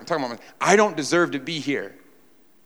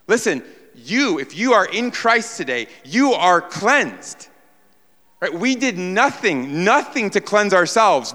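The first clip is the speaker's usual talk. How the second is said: high, loud preaching to the congregation; full-force preaching; 7 semitones higher, 5 dB louder than usual. Real, not acted. Pastor urging the congregation to face what they deserve, full-force preaching. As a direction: drive it home like a revival preacher.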